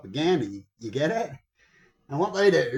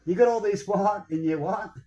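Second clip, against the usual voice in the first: high, louder mocking huh; mocking taunt; 5 semitones higher, 4 dB louder than usual. Man to certain countries, mock-incredulous taunt. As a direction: scoff at them in disbelief. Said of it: "what I love to say to certain countries".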